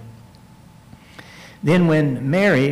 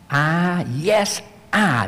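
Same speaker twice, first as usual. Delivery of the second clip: each "I" stressed and set apart, "yes" raised high; emphatic I; 4 semitones higher, much slower than usual. Shown as a demonstration. Preacher showing how the verse might read, emphatic "I". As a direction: lean hard on both "I"s, lifting "yes". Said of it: "You might read it like this".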